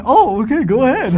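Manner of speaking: high, loud, falsely cheery okay go ahead; mock cheerful okay. Husband imagining wife's fake cheerful permission, high and loud. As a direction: pretend the wife is happily agreeing. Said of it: "it does not mean".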